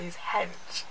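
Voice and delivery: a sudden whispered aside. Retold or imitated. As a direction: drop to a whisper to defend him.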